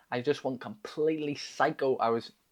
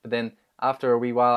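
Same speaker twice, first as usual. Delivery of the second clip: ordinary talk, nothing marked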